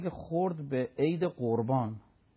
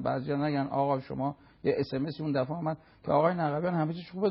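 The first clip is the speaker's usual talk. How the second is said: normal talking voice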